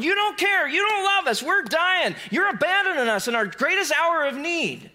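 high, loud, frantic pleading; panicked cry. Acted. Disciples shouting at Jesus in the sinking boat, panicked. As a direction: cry out like men about to drown.

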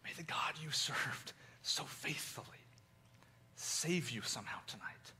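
soft unvoiced blessing; the king whispering into the den at night. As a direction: breathe a whispered prayer over him. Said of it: "barely heard a whisper".

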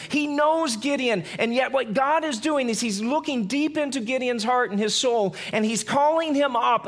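ordinary talk, nothing marked

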